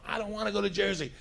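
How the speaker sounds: high, drawn-up mock wail without volume; retold protest yell. Woman protesting the ride to Jersey, high mild mock yell. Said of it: "She's yelling".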